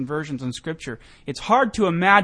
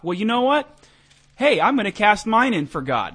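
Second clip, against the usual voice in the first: higher, louder, breezy voice as if shrugging; mock casual decision; 6 semitones higher, 6 dB louder than usual. Host imitating Paul deciding for God, breezy and mock casual. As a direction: toss it off like choosing on a whim. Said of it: "Paul decides after he gets knocked off".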